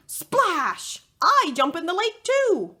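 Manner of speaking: ordinary delivery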